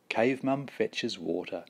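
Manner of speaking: ordinary delivery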